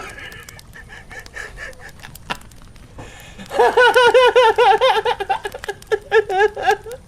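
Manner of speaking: very high, long villain cackle; evil laugh. Acted. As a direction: cackle high like cursed Mario. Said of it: "the worst Mario impression".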